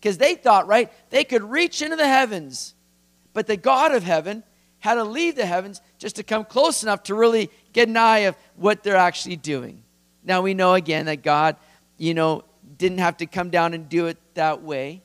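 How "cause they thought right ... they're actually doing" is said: high, emphatic mock amazement; lightly sarcastic retelling, pitched up. Preacher retelling God's view of the tower builders, sarcastic. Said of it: "a kind of sarcastic tone".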